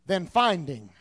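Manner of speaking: normal talking voice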